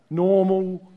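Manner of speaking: usual speaking voice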